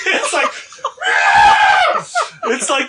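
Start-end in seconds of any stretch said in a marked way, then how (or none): 0.1-2.5 loud laughing scream, much higher; moose scream through laughter. Retold or imitated. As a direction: shriek like a moose, cracking up. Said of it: "You should do it again".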